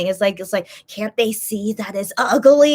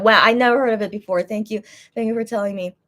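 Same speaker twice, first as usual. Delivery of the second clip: ordinary talk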